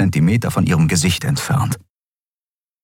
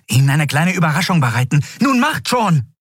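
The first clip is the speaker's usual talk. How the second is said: high, rushed, rising to a shout; hurried order; 8 semitones higher than usual. A narrator's reading. Andriel preparing a surprise under pressure, hurried command. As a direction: hurry them along impatiently.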